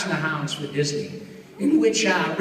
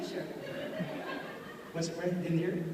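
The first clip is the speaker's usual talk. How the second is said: ordinary delivery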